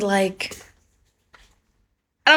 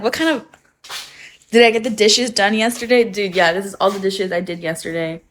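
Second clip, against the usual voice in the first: normal talking voice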